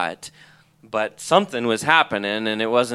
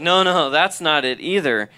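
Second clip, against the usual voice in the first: higher, louder retold protest; insistent correction; 5 semitones higher, 5 dB louder than usual. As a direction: wave off the wrong guess emphatically. Retold correction of mentor's guess.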